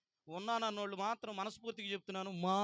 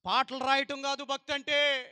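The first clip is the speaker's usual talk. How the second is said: preaching at full force; 5 semitones higher, 11 dB louder than usual. Acted. Preacher thundering at congregation.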